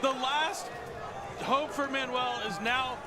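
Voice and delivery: hyped caster call, building; excited shout. Real, not acted. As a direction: yell the finish over the crowd. Casting climactic game-ending play.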